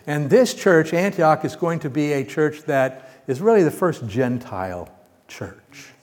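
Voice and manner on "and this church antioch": normal talking voice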